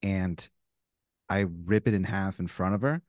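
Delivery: low, quiet, controlled indignation; stern refusal. Acted. Homeowner refusing the neighbor's vet bill, stern and low. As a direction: warn them off, low and measured.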